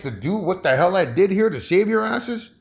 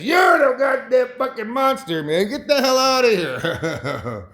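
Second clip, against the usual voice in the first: crowd yelling back; 6 semitones higher than usual. Retold or imitated. Peoples yelling back at aliens.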